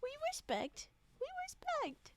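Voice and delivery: high protest. Acted.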